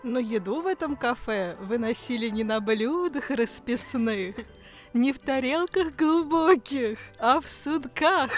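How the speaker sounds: singsong folk-tale lilt, rising at the end; playful storybook cadence building to the punchline; fairy-tale storytelling. Pretend. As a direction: recite it like a mock folk tale. Improvised fairy-tale narration.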